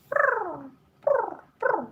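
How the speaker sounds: high cooing purr; pigeon coo imitation. Host imitating her other cat's cooing. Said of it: "She goes".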